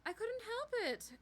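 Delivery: high, loud defensive snap; heated, high-pitched protest; angry outburst. A narrator's reading. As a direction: lash out in self-defence. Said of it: "Anger flashed across her face".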